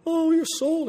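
high-pitched imitated lament; mock wailing. Women on the Via Dolorosa crying for Jesus, mock wailing. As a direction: imitate mourners wailing over someone. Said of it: "crying for him".

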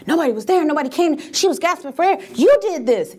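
rising, accusing; mild grief accusation. Retold or imitated. Grieving family blaming the aunt, rising accusation. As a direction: build to blame.

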